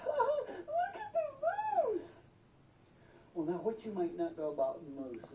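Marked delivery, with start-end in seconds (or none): 0.1-2.2 high, loud, drawn-out squeal; shrill excited shout. Retold or imitated. Camper spots a moose and tells everyone, shrill excited shout. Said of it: "shrill voice".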